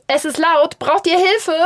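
put-on mother to children upstairs, calling out loud. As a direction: call up to the kids from below.

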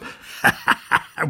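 king's loud, high roaring laugh. Acted. Tyrant king mocks the crying dwarf, roaring laugh. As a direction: bellow with cruel laughter. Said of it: "brüllte der König".

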